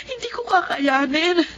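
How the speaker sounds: put-on tearful plea for the children; crying prayer. Mother prays, fearing for children.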